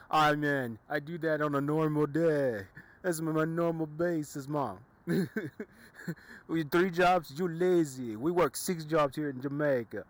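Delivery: hammed-up Jamaican accent, high and loud; a bad, over-the-top Jamaican accent; terrible Jamaican accent. Joking about a Jamaican guy working six jobs, hammed-up Jamaican accent. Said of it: "My terrible Jamaican accent going ham".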